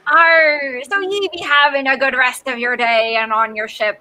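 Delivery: put-on pirate talk, opening on a growl. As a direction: send them off as a jolly pirate. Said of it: "do it in our pirate voices".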